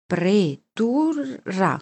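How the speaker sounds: very slow, drawn-out syllables; reading aloud. A narrator's reading. Boy deciphers a sign in town, slow syllable-by-syllable reading. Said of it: "lessi io".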